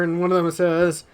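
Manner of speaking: ordinary delivery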